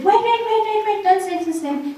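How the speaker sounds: urgent interruption. Retold or imitated. Someone bursts into courtroom.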